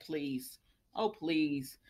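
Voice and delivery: low, soft scoff; scoffing please. Real, not acted. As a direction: dismiss it under your breath. Scoffing at Thomas's claim.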